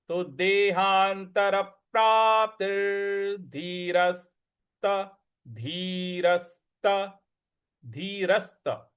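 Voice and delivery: धीरस्त slow with force on the स; stress on स. Shown as a demonstration. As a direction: lean on the स in धीरस्त. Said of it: "उस पर हमको बल लगाना है".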